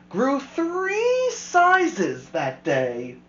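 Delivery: slow climax. A narrator's reading. Story climax, slowed.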